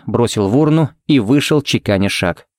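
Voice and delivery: ordinary delivery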